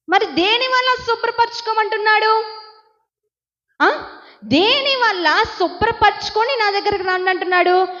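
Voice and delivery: high, driving sermon delivery; forceful preaching. Real, not acted. Preacher builds to full force.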